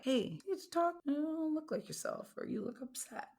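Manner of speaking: high, softening caring voice; tender mock concern; caring check-in. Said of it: "somebody looks at you and is like".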